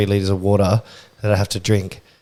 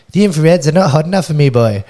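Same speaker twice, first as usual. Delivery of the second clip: quick, raised Welsh accent impression; 7 semitones higher, 5 dB louder than usual. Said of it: "that a Welsh accent?".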